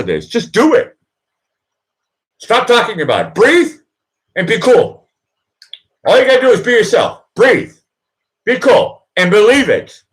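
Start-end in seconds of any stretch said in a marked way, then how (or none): none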